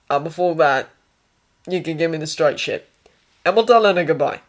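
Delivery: blunt Australian patient; Australian accent, in broken phrases. Acted. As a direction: demand it plainly in an Australian accent. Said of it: "Australian, that was what the accent".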